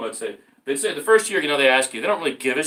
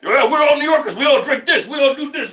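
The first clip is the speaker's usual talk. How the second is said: much higher, louder, sing-song mockery; mocking New Yorkers; 11 semitones higher, 7 dB louder than usual. Mocking New Yorkers for his friend, higher, louder, laughing. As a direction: parody the proud crowd, laughing.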